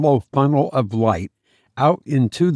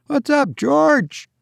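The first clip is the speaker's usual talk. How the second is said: high-pitched distant shout; calling out; 14 semitones higher, slower than usual. A narrator's reading. Will shouts to George from the scuttle, calling out. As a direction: holler up to a shipmate.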